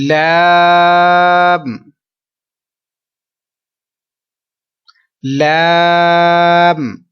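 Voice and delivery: long model لام; three-beat لام. Shown as a demonstration. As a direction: stretch لام without nasal. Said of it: "تین second تک لمبا کرنا ہے".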